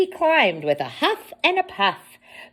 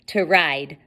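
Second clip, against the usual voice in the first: ordinary delivery, nothing marked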